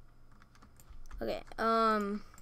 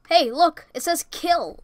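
ordinary talk

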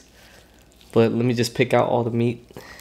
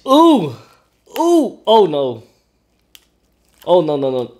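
high, loud startled squeals; scared squeals; 6 semitones higher, 6 dB louder, slower than usual. Real, not acted. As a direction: shriek in real fright. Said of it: "I'm kinda scared now".

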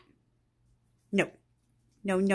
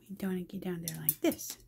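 soft, fast whisper; 8 dB quieter, faster than usual. Real, not acted. Mom whispering quickly to Peanut. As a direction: hush it, quick and breathy.

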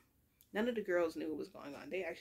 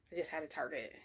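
ordinary talk

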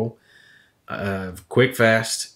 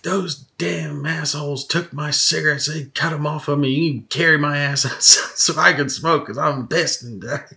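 mock angry cussing; 6 semitones higher than usual. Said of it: "I started yelling and cussing at him".

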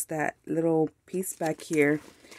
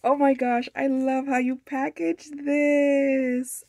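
delighted gushing; 7 semitones higher, slower than usual. Real, not acted. Gushing over the packaging.

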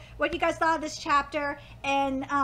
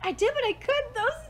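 ordinary talk, nothing marked

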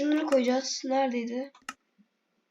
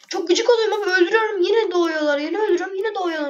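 real gamer frustration, voice up high; frustrated yelling; 6 semitones higher, faster than usual. Real, not acted. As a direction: whine at the slimes that keep coming back. Fighting respawning slimes.

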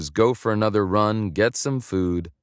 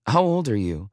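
a quick blurt, a touch higher; 3 semitones higher than usual. A narrator's reading. A young woman blurts a question to the man, impulsive. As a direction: let it tumble out before she can stop it. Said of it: "she blurted".

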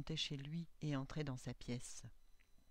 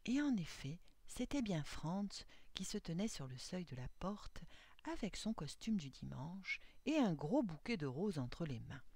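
plain reading voice

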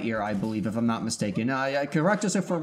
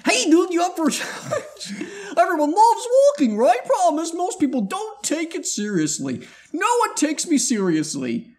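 squeaky cartoon character, much higher and louder; 14 semitones higher, 6 dB louder than usual. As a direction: pitch way up like a cartoon critter.

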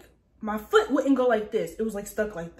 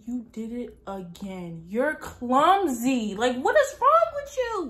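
self-scolding; 2 semitones higher, slower than usual. Retold or imitated. Woman berating herself for being clumsy, rising exasperated scolding. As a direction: draw it out, then shoot up in pitch.

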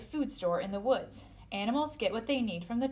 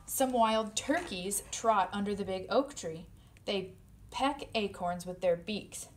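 plain reading voice

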